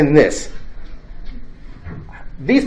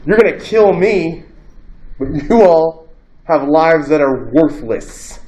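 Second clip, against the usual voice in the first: Socrates' defiant verdict; 2 semitones higher, slower than usual. Retold or imitated. Socrates telling the jury their lives are worthless, slow and grave. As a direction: lay each word down slowly. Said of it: "Now he's also saying here".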